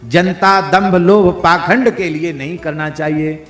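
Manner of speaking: loud, slow, word-by-word list; forceful sermon delivery; fervent preaching. Real, not acted. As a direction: hammer out each word to the crowd.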